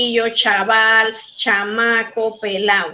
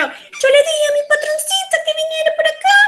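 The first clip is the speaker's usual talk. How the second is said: very high, fast singsong Mexican accent; hammed-up Mexican intonation; much faster than usual. Shown as a demonstration. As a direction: exaggerate the Mexican lilt, quick and high. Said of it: "That is Mexican".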